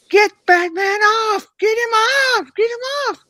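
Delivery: high, loud mock yelling, drawn out; hammed-up screamed order; imitated screaming. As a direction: holler it like an irate man making demands. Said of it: "he's screaming at me".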